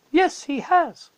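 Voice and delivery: small sad admission; Lucy. A narrator's reading. Lucy admitting to Mr Beaver, high and slow.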